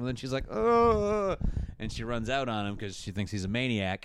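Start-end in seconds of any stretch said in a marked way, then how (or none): 0.5-1.4 high drawn-out "oh"; comic high wail of dismay; mock alarm. Host as heroine hearing he is Santa, mock-alarmed oh.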